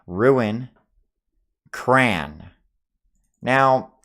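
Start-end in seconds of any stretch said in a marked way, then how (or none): none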